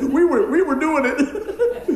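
high, loud, laughing mock confession; laughing through a high, loud admission; blurted class confession. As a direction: crack up while imitating the kids owning up. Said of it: "everybody just said".

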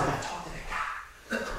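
near-whispered thought to himself; hushed aside. Retold or imitated. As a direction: whisper it like a secret resolve. Quoting his own private thought.

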